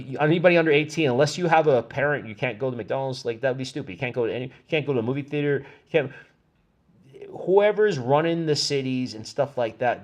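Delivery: ordinary talk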